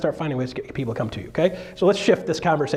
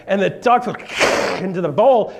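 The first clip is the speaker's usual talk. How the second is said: ordinary delivery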